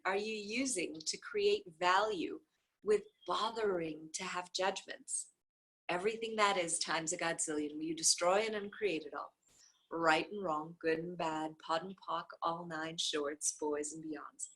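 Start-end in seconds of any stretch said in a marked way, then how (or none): none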